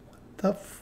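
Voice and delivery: hushed, cut-off near-whisper; imitated disbelief under the breath; mock baffled aside. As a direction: mouth a half-swallowed curse, as retold. Retelling trainer's baffled aside.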